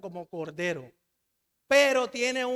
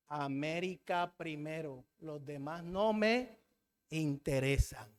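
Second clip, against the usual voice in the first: slow, low and soft, dismissive; deliberately drawn-out, quiet indifference; cold dismissal; 6 semitones lower, 7 dB quieter, slower than usual. Acted. Preacher voicing a nation to the others, coldly dismissive.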